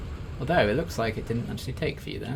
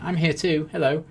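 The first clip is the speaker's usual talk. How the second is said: loud, higher-pitched call for attention; wheelchair guy voice; 8 semitones higher, 8 dB louder than usual. Retold or imitated. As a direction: call out loudly like the ignored guy. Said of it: "that guy in the wheelchair being like".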